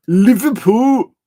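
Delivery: loud, high, stretched mock-Scouse; bad Scouse accent. Shown as a demonstration. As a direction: butcher a Liverpool accent, loud and drawn out. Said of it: "I can't do a Scouser's accent".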